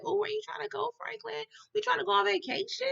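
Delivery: high-pitched playful curiosity; eager questioning. Acted. Viewer asking Franklin where the trip is, eager questioning. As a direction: ask in a high, eager lilt.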